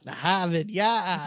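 mock Boston accent, drawn out; lightly hammed-up Boston accent. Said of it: "can you do a Boston accent".

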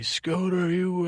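high, drawn-out calling voice; mock caller message. Imagined listener leaving a phone message for the show, mock caller voice. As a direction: pretend caller, pitched well up.

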